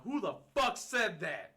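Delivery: high, loud, slow confrontation; loud challenge. Retold or imitated. Loud challenge to the cowboys behind him. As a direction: call them out across the bar. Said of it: "Like, real loud".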